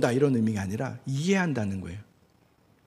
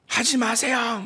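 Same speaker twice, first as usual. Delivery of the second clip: mock scolding; 10 semitones higher, slower than usual. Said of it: "막 그렇게 말하지 않아요".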